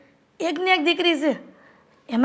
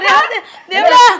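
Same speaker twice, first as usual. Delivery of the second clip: shout; 5 semitones higher, 7 dB louder than usual. Acted. Mother calls son to stop.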